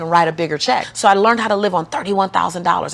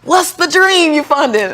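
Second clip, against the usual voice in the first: eager tellers; 8 semitones higher, 5 dB louder than usual. Retold or imitated. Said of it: "they were like".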